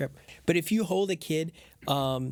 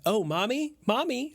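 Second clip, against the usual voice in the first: imitating a toddler; 10 semitones higher than usual. Boy imitating the toddler's call.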